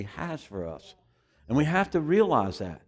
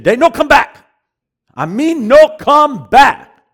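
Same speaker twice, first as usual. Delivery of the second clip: loud, high, halting broken English; imitating the Singapore preacher; 13 semitones higher, 17 dB louder, slower than usual. Preacher imitating the Singapore man's prophecy, loud and halting. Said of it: "He said".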